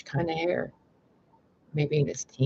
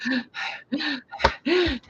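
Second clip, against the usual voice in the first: a high, panicked intake of breath; retelling the attack with a high gasp; sharp high gasp; 13 semitones higher than usual. Retold or imitated. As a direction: gasp as if something lunges at you. Crawling as walls attack.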